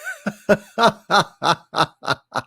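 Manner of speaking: long, breathy burst of real laughter; helpless laugh after the joke. Real, not acted. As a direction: crack up at the punchline.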